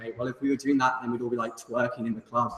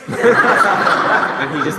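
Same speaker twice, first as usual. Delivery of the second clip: very slow, louder, dissolving into laughter; laughing; 6 semitones higher, 11 dB louder, much slower than usual. Real, not acted. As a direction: laugh out the awkward ending. Laughing at own story.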